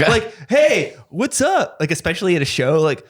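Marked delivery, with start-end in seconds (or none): 0.5-1.8 high, drawn-out mock-friendly greeting; overeager greeting. A pushy performer greeting people at a show, mock-overfriendly. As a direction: imitate a too-friendly show-off, high and slow.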